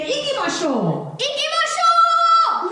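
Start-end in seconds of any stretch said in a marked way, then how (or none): 1.2-2.7 much higher, slow, sung out; drawn-out singsong battle cry; rallying cry. Shown as a demonstration. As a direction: call the troops to go.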